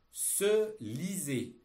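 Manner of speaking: slow, loud and distinct dictation; dictating. Shown as a demonstration. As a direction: read each word out for pupils to copy. Teacher dictating text to pupils.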